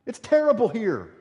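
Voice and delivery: high, whining, mocking complaint; mock the grumbling people; whiny complaint. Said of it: "And they'd say".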